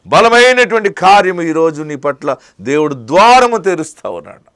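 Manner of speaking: loud, high, impassioned preaching; fiery preaching. Acted. Preacher promising weeping believers healing, loud and fervent. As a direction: preach it out to the crowd with full force.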